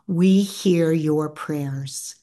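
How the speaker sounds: slow, measured delivery; slowly voiced message from a dream visitor; solemn quoted message. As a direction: draw each word out slowly, a little low. Great-aunt's message in a dream.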